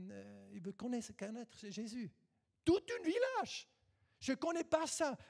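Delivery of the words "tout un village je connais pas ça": excited. Real, not acted. Preacher exclaiming in sermon.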